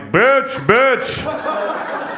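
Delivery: high, put-on stirring voice; sly instigator. Bystander trying to make argument escalate, slyly goading. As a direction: egg on the fight in a disguised voice.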